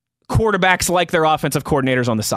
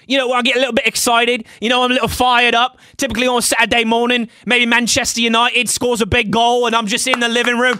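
put-on British accent, pitched higher; excitable British football fan, higher voice; 5 semitones higher than usual. Shown as a demonstration. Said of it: "can I get that British accent".

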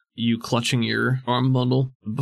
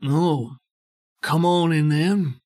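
gravelly boss voice; 4 semitones higher, slower than usual. Acted. GM voices Roth.

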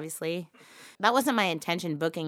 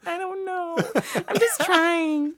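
very high, squeaky plea; octave-jumping high voice; 11 semitones higher, slower than usual. Acted. Explaining the bad accent to a bandmate, very high voice. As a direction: answer helplessly way up high. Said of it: "octave of your voice go up".

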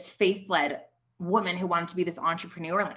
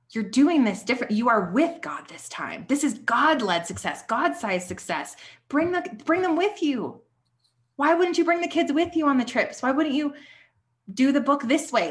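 louder and higher, urging with conviction; passionate encouragement; 6 semitones higher, 4 dB louder than usual. Retold or imitated. Coach urging the hesitant woman to embrace success, impassioned. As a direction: push her to believe it, fired up.

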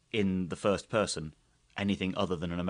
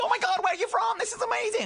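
hammed-up squeal of amazement; shrieky mock-American gushing, pitched way up; waitress losing it; 4 dB louder than usual. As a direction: imitate a starstruck waitress, very high and loud. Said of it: "the waitress just properly lost her shit".

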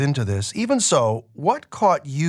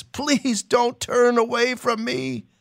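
high, slow begging; desperate plea; 10 semitones higher, slower than usual. Acted. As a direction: plead on his knees. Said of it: "the leper cries".

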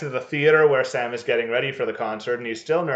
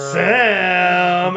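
long drawn-out loud call, hammed-up; imitated calling out; 3 semitones higher, 6 dB louder than usual. Co-host mimicking Al calling his friend, hammed-up call. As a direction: call the name as if trying to get attention. Voicing Al calling to oblivious Sam.

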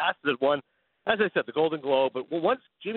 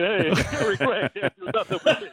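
loose put-on Tony Curtis voice, climbing much higher and breaking into a laugh; Tony Curtis impression; 5 semitones higher than usual. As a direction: ham up old Hollywood Tony Curtis, laughing through it. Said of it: "best Tony Curtis impression I got".

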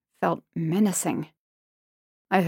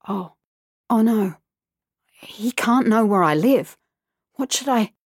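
panicked whisper; 4 semitones higher than usual. Acted. Panicked inner thought.

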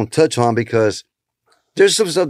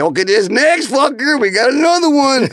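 much higher, hyped stage announcer; hyped emcee intro; 11 semitones higher than usual. Retold or imitated. Comic on stage introducing the next act, high hyped emcee voice. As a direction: sell the next act like a club host. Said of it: "So I went on stage".

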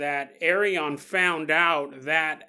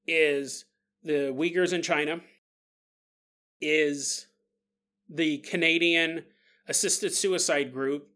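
ordinary delivery

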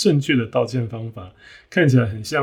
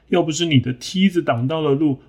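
higher, whiny blaming tone on 要不是; defensive excuse; 5 semitones higher than usual. Acted. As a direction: push the blame back defensively. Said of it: "这个河马说".

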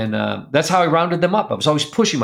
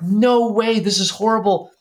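high, pitched-up cry of dismay; reliving the horror of finding the frozen lambs; shocked disbelief; 10 semitones higher than usual. Retold or imitated. Farmer reacting to twenty-one frozen lambs, shocked and high. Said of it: "And I'm like".

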